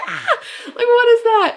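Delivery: a hammed-up terrified yell; mock scream. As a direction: scream like you've been caught off guard. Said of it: "we scream bloody murder".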